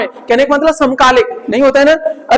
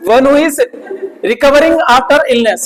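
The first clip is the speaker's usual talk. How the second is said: slow dictation; much slower than usual. Shown as a demonstration. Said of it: "dictating the meaning".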